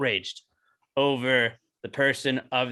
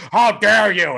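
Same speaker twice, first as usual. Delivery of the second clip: a louder hammed-up cry of indignation; mock outrage; 4 semitones higher, 8 dB louder, slower than usual. Mocking outraged Twitter users, a hammed-up loud cry. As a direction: exaggerate the offended gasp of it.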